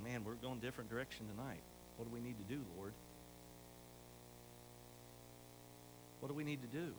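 hushed, quick thinking to himself; low, fast, pondering aloud; quiet inner voice. Retold or imitated. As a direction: murmur it fast like a private prayer. Preacher's inner thoughts, quoted.

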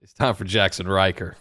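low, quiet deadpan; deadpan correction. Pretend. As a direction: say it low and dry. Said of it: "uh, no".

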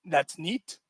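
flat, unimpressed "That's neat"; quoting dad's deadpan "That's neat"; deadpan reaction. Retold or imitated. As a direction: shrug it off dryly. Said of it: "my reaction was".

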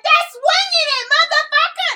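high, loud mock Chrissy snapping at her rival; imitating Chrissy. Retelling a Love and Hip Hop fight to her cohosts, high mock yell. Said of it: "She was like".

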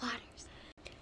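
very soft whisper; whispered prompt. Pretend. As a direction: whisper it under your breath. Whispering the prompt under the jingle.

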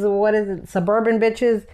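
normal talking voice

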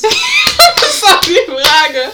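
words squeezed out through laughter; laughing. Real, not acted. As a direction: laugh while reading it slowly. Lacht beim Vorlesen der Frage.

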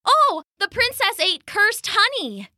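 high, excited realization; sudden realization. Acted. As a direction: it dawns on him all at once.

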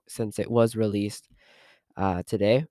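normal talking voice

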